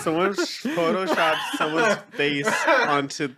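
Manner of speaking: high laughing start, settling as it goes; real giggles under the words; talking through laughter. Real, not acted. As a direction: try to explain while cracking up. Describing the meme while laughing.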